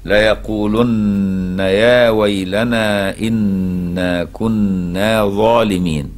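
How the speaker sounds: very slow drawn-out chant; Quran recitation. Shown as a demonstration. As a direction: stretch every vowel as in recitation. Teacher recites verse. (تلاوة بطيئة ممدودة)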